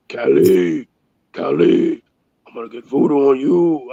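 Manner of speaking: low, menacing put-on voice; threatening voodoo man. Guy online threatening him with voodoo, low menacing put-on voice. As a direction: growl the threat like a voodoo caster, deep. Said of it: "I had a dude threaten voodoo on me".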